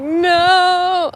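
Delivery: high, loud mortified no; embarrassed no. Retold or imitated. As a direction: blurt it out mortified. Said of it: "I was like".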